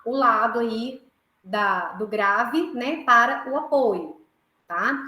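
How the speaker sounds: ordinary talk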